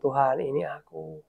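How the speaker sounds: low, soft address to God; hushed prayer. Retold or imitated. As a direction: say it quietly, alone with God. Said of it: "Saya hanya berdua hening dengan Tuhan".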